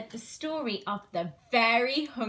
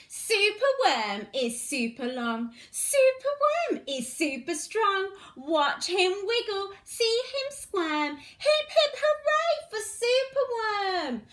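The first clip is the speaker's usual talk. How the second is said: lively, sing-song storybook reading; animated picture-book read-aloud; reading to children; 5 semitones higher than usual. Acted. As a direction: read it bright and bouncy for little ones.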